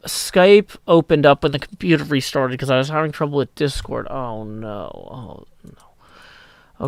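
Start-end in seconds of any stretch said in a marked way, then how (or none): 4.1-6.9 soft, sinking to a whisper; quiet dismay. Real, not acted. Streamer fearing the recording is ruined, hushed dismay. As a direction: let it fade into a dismayed whisper.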